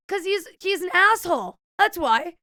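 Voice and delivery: high, loud, exasperated comeback; indignant retort. Retold or imitated. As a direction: snap back in self-defence. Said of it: "Why were you yelling at a table?".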